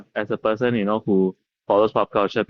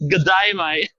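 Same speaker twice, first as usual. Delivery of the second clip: mock Australian; 6 semitones higher, slower than usual. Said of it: "Say it again?".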